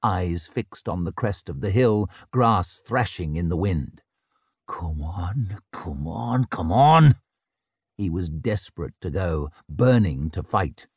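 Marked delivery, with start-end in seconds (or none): 4.7-7.2 building urgency. A narrator's reading. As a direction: start under your breath, build to a burst. Impatient fighter urging himself, building.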